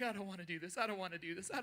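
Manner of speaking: small, soft frightened voice; scared whimper. Retold or imitated. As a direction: mutter it like a scared little kid. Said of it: "Brittany got super scared".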